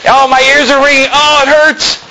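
high, hammed-up whine of pain; exaggerated high-pitched moaning; mock complaining. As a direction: wail as if the noise hurts. Mimics listener hurt by the shouting.

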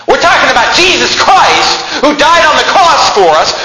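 full-force preaching. Acted.

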